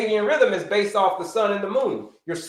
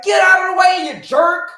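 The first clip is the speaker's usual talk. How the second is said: high, loud yell at a driver; mock road-rage shout; 11 semitones higher, 8 dB louder than usual. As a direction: bark it out like an angry driver honking.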